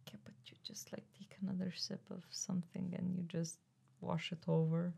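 whispering. Real, not acted. Whispered aside.

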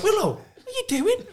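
high, slow, disbelieving squeak; baffled squeal. Retold or imitated. Lads gawping at Willow getting a massage in front of the telly, high and disbelieving.

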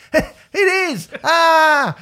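high, loud and gleeful; bubbling over with glee; jolly. Real, not acted. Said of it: "That's the most jolly I've ever heard you".

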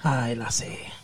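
whispered "Aye, lassie" with no voice. Acted. Host whispering a tease at his girlfriend. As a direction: breathe it as a creepy whisper.

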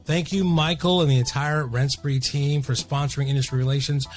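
soft, even sponsor read. Acted. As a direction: read the sponsor spot smoothly. Sponsor ad read over music.

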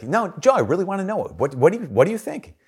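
high-pitched imitation of a pushy coworker; insistent pressing. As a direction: press him eagerly for an answer, pitched well up. Retold Richard pressing Joe for answer.

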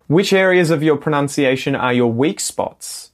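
ordinary talk

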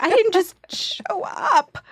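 high, slow, loud whine; hammed-up offended protest; mock wounded outrage. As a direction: drag it out as an indignant wail. Acted indignation at the audition.

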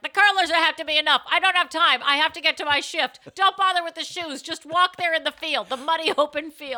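put-on a harried mother brushing off her kid; rushed mom. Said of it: "she's like".

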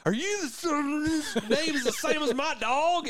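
high, mock-indignant voice; confronting rival. Confrontation between hunters.

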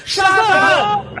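high-pitched yell to finish the countdown; playful hammed-up superhero cry; shouted magic word. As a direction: belt out the magic word to transform. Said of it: "a gente grita Shazam".

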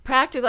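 high, loud emphatic repeat; an emphatic one-word agreement; emphatic echo. Real, not acted. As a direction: punch the word back. Echoing interviewer with emphasis.

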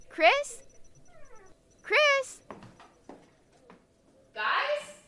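high calling for Chris. Real, not acted. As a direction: call across the room.

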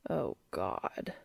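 breathy low whispered moan. A narrator's reading. Cass moaning to Dean over the phone, low whisper. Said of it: "Cass moaned".

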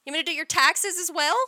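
high, quick, showing-off eagerness; eager show pony. Retold or imitated. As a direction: offer it brightly and fast, preening. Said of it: "Like a fucking show pony".

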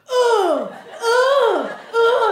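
high, breathy grunting moans; tennis grunt. Retold or imitated. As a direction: grunt like a tennis player mid-swing. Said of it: "every guy's like".

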